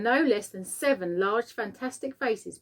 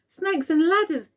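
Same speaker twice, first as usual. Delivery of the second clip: much higher, loud panicked yell; 9 semitones higher, 5 dB louder than usual. A narrator's reading. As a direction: blurt it out in alarm. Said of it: "yelled the head of the fire department".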